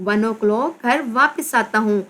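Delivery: ordinary talk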